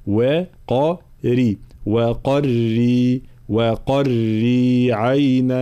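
وقري said very slowly, ra kept thin after the heavy qaf; slow model pronunciation; correct light ra. Shown as a demonstration. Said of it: "هكذا".

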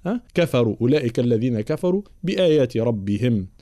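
normal talking voice

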